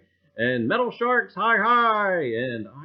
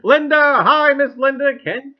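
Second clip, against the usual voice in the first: excited greeting; 13 semitones higher, 16 dB louder than usual. Real, not acted. Greeting chat viewer.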